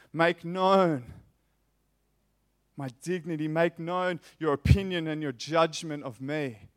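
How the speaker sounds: loud, slow pleading to the Father; preacher voicing Jesus' loud prayer; impassioned prayer. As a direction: plead "Make known my worth" slowly. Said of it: "his prayer to the Father is".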